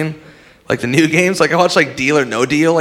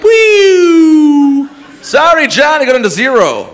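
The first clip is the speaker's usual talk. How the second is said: high, loud cheery game show host shout; 13 semitones higher, 7 dB louder, slower than usual. Retold or imitated. Comic mocking Wheel of Fortune host announcing bankrupt. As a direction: belt it like a gleeful host. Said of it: "they're like".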